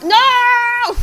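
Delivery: very high, loud long wail; anguished cry. Real, not acted. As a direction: wail in dismay. Dismay at losing life.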